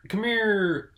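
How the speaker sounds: high, loud cartoon father calling his son; cartoon dad calling. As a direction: holler for the boy, slow on boy. Opening skit calling a boy.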